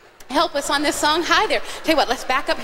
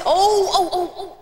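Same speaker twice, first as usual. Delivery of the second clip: mock sudden realization; 3 semitones higher, slower than usual. Host pretends he forgot to tell kids something, mock alarm. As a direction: play up suddenly remembering something.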